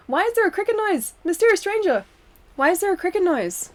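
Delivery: high-pitched indignant questioning; mock exasperation. Real, not acted. Protesting chat's cricket noise.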